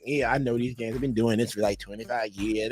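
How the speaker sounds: a hint of a smug expert bragging; know-it-all Carlton. Mocking Carlton who claims he knows games, low smug voice. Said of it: "Carlton, he come on walking and come like".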